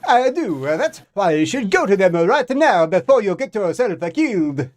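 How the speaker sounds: put-on loud, high Italian accent. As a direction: warn him in an Italian accent. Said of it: "loud Italian accent".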